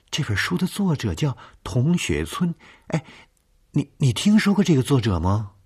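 high, urgent, stammering; nervous questioning. Acted. Woman asks nervously.

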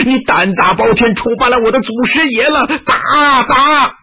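high, frenzied shouting with wild laughter; crazed yelling. A narrator's reading. Dazed disciple wildly defending Wudang Mountain, deranged high yelling. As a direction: yell like a man gone mad, pitch climbing. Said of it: "大叫道".

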